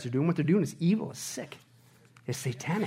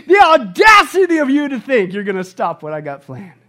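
lightly voiced God, louder, scornful; a hint of God scolding the little schemers; God scoffing; 5 semitones higher, 13 dB louder than usual. God addressing the scheming rulers, lightly voiced scorn. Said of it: "Look at these little pipsqueaks going".

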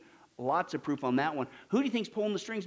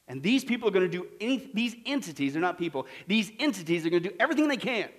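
normal talking voice

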